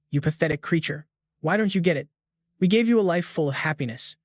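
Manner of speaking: ordinary delivery, nothing marked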